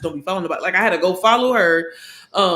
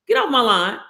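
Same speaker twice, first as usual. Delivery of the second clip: mock brush-off; 6 semitones higher, 5 dB louder than usual. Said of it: "Missy could have been like".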